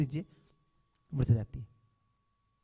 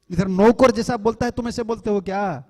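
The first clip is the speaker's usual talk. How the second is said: louder rebuking question; scolding; 4 semitones higher, 8 dB louder than usual. Retold or imitated. Monk scolding his temple devotees, loud rebuke. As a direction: chide them for their rude speech.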